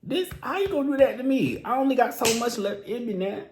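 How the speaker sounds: high, fast exasperated plea; overwhelmed protest. Real, not acted. Fan protesting how much the song hurts, high and fast. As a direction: throw up your hands at her.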